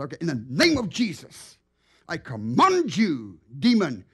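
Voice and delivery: retold rebuke that builds to a shout; commanding the demon.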